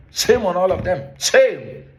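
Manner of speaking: angry outburst. Real, not acted. Vlogger denouncing Ghana's lawmakers, loud indignant shout.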